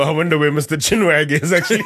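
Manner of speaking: laughing. Real, not acted.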